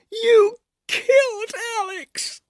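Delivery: high, slow tearful shriek. Acted. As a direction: shriek it through sobs at him. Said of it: "She shrieked the words".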